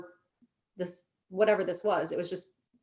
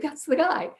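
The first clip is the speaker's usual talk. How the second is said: excited; 8 semitones higher than usual. Retold or imitated. Spotting him at the gym.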